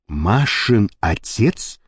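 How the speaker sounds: low, slow, wondering question; puzzled asking again. A narrator's reading. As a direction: repeat it in disbelief. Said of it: "переспросил".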